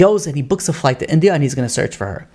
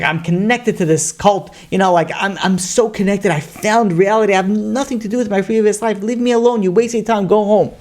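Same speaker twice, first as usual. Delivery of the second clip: sister's voice pitched higher, rising to a push-away; defiant sister; 6 semitones higher than usual. Retold or imitated. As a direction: brush him off, higher and higher. Sister rebuffs brother in story.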